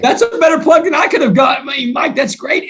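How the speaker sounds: overjoyed laughing shout of praise; excited shout. Real, not acted. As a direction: burst out in real delight. Delighted by the host's plug.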